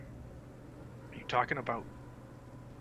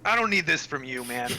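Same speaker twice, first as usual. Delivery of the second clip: defiant snap; 5 semitones higher, 8 dB louder than usual. Pretend. Player snaps back at gods.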